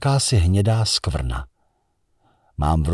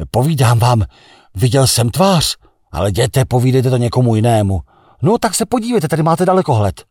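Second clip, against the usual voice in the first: much higher, louder, then rushing; excited insistence; 5 semitones higher, 5 dB louder than usual. Acted. A crewman urging others to look, excited and loud. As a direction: insist eagerly, almost pleading.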